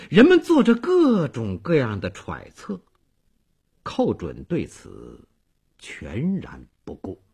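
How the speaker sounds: ordinary delivery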